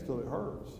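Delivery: normal talking voice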